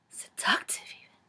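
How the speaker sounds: whispered, mock sultry; breathy whisper read. Vlogger whispers the book's sultry phrase mockingly. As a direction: breathe it like a romance novel.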